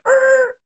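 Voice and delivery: very high squeaky er noise; a goofy squeal; squeak. Retold or imitated. Kid squeaking when coach says freeze. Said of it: "one kid went".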